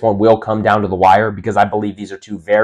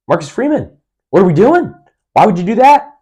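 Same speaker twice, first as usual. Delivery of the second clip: much higher and louder, mock-baffled fan; incredulous outburst; 10 semitones higher, 5 dB louder than usual. Fan questioning coach Marcus Freeman's call, high incredulous cry. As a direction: throw your hands up at the coach's call. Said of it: "you're gonna think".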